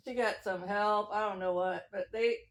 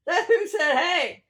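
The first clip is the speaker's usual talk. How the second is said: high, loud playful call; hammed-up loud "Hey"; 5 semitones higher, 8 dB louder, slower than usual. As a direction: call it out louder and higher, like a Who wanting to be heard. Repeats the Who calling out louder.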